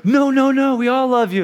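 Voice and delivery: loud, high-pitched mock gushing; gushing reassurance. Everybody consoling someone who claims nobody likes them, loud gushing reassurance. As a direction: rush to reassure them, voice shooting up.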